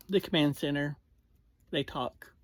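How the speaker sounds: ordinary talk, nothing marked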